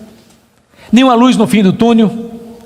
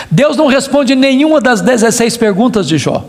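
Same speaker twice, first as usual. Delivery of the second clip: normal talking voice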